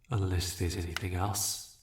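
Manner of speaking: ordinary talk, nothing marked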